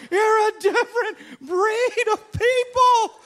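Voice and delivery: high, loud, slow laughing cry; laughing admiration. Retold or imitated. Preacher marvelling at tough hockey players, laughing loudly. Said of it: "And I'm going".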